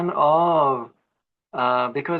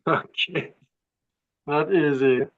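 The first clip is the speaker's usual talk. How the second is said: lips held still while speaking; talking with mouth closed; slower than usual. Real, not acted. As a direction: speak without moving the mouth. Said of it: "I will try to speak without moving my mouth".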